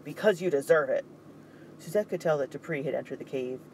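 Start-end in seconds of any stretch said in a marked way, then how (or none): 0.0-1.0 loud, cutting retort; curt scolding. Acted. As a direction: slap him down with the words. Dupree berating Mikhail.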